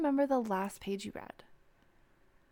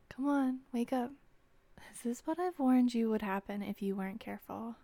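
ordinary delivery

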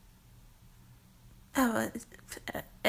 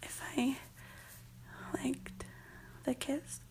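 shy, hushed; 10 dB quieter than usual. Acted. Shy answer about a kiss.

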